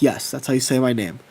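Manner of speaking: put-on beaten-down kid conceding; resigned giving in. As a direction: sigh it out and just give up. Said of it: "I've given up".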